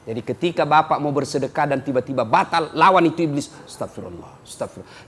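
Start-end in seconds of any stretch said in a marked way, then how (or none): 3.6-5.1 barely whispered astagfirullah, very soft; mock whispered prayer. Stingy man pretending to repent, whispering astagfirullah. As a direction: breathe "astagfirullah" under your breath.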